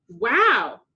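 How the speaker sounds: loud impressed wow; dad shouting after the punch; excited shout. A narrator's reading. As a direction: shout out amazed. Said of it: "the professor shouted".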